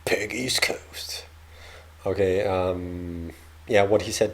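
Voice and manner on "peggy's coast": slow whisper to herself. Real, not acted. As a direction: whisper the name, trying it out.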